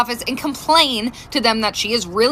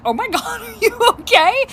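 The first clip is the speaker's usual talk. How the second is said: high, laughing concern; laughing through it; 8 semitones higher than usual. Retold or imitated. Mom laughing at her fake-sick daughter. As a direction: giggle out the question. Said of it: "I burst out laughing".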